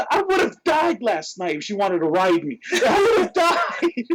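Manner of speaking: high mock wail breaking into laughter; distressed protest. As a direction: wail it out in comic horror. Comic protest over the night.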